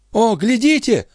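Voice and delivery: very high-pitched exclamation; sudden high call; sudden cry. A narrator's reading. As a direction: cry out to make everyone look. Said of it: "вдруг крикнул смотритель".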